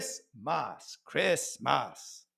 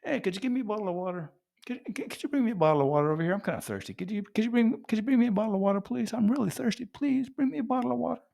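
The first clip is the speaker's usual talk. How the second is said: haphazard American; 7 semitones higher, much faster than usual. Acted. Thirsty American asking for water, haphazard and rushed. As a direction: spit it out quickly like nothing. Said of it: "we just haphazardly spit it out like nothing".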